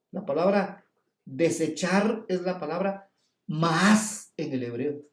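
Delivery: ordinary talk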